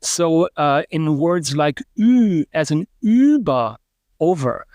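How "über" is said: "über" with a rounded front ü, lips pushed forward. Shown as a demonstration. A linguist showing the rounded front vowel in "über". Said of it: "have to really round your lips to get that ü sound".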